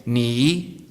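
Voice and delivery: "Ni" dipping down, then rising up; "Ni" with a falling-rising Mandarin tone. Shown as a demonstration. Speaker teaching the audience Mandarin, "Ni" dipping then rising. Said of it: "So just once again".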